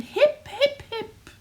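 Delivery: high-pitched hooted cheer; high storybook hurrah; happy hooting. A narrator's reading. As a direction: hoot a celebration for the hero. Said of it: "They hooted".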